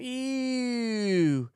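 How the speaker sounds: high, squealed ew of disgust; grossed-out squeal. Real, not acted. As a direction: recoil from the picture with a high ew. Disgusted at spider photo.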